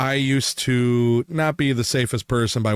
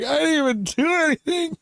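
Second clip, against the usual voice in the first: much higher, squeaky innocent protest; falsely blamed coworker's high squeal; mock innocent protest; 14 semitones higher than usual. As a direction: squeal it like you're wrongly accused. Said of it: "And I'm like".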